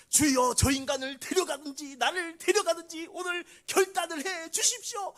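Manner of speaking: high, loud, near-sung desperate plea; desperate prayer cry. Retold or imitated. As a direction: cry out to God in anguish. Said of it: "막 그러면서". (높고 크게 절규하듯 부르짖는 기도)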